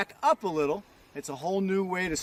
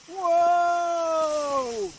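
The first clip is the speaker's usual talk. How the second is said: surprised whoa; 8 semitones higher, 4 dB louder than usual. Real, not acted. Sliding backwards on skis.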